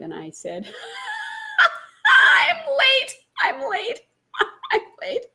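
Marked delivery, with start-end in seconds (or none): 0.7-2.0 high squealing laugh; laughter breaking into a shriek; laughter burst. Real, not acted. 2.1-5.3 high, loud laughing cry of I'm late; laughing helplessly into the phone; laughing high shout. Retold or imitated.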